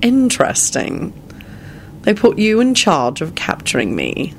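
slow, gloating villain; drawn-out, disdainful gloating; nasty. A narrator's reading. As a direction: relish the captive's plight nastily. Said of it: "he finished nastily".

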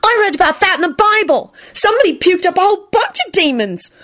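high, alarmed wailing voice. A narrator's reading. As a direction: wail the explanation in a high pitch. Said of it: "Esther wailed".

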